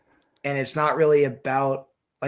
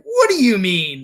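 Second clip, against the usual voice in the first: mock exasperation; 4 semitones higher, 5 dB louder than usual. Acts own imagined exasperated reply.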